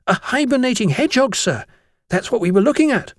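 put-on high-pitched boy explaining himself; eager schoolboy. Jennings explains himself to the master, high eager voice. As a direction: gush out your story to the teacher.

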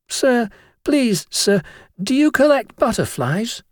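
high-pitched, eager little schoolboy; chattering schoolboy. Acted. Blotwell asks his master about butterflies, high schoolboy voice. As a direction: pipe up politely as a young pupil.

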